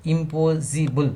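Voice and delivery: impossible broken slowly into im-pos-si-ble; syllable by syllable. Shown as a demonstration. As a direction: say impossible in separate, measured beats. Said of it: "Words with more than two syllable".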